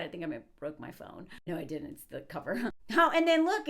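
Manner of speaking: ordinary talk, nothing marked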